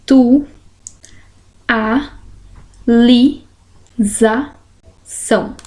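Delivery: "tu, a, li, za, são" each isolated by a pause; sílaba por sílaba. Shown as a demonstration. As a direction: count out "tu, a, li, za, são" syllable by syllable. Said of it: "Como que vai separar?". ("tu, a, li, za, são" pausado, sílaba por sílaba)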